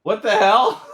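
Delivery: high, loud baffled cry; shocked yell. Real, not acted. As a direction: yell in disbelief. Boat drove away.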